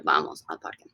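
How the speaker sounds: hushed, voiceless echo of the phrase; whispered repeat. Shown as a demonstration. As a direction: repeat it softly under your breath. Teacher repeats answer under breath.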